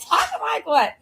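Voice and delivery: high, puzzled comeback; retold innocent question; feigned innocence. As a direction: play clueless, pitched well up. Acts her innocent reply to brothers.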